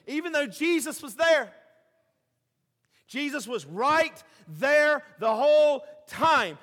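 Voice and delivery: slow, loud declaration; emphatic preaching. Acted. Pastor stressing Jesus was there, slow loud preaching. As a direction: drive the point home slowly.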